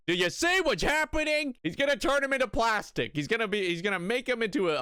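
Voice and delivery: high-pitched, worked-up warning; mock alarm. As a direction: cry out in hammed-up alarm. Alarmed reaction to the plot.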